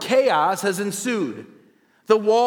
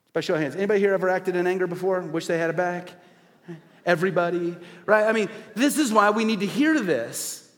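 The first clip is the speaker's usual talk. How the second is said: ordinary delivery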